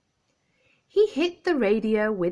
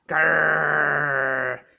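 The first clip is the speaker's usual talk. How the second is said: low, louder growling rumble; 4 semitones lower, 4 dB louder than usual. Acted. Teddy growls back at the dog shaking him, low growl. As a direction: growl like a cross teddy bear. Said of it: "growled Teddy".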